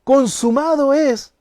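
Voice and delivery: consumado es called out high, loud and slow; triumphant cry. Shown as a demonstration. Preacher voicing Jesus' final words as a loud cry of triumph. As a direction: proclaim it as a victory. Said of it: "dice esta palabra".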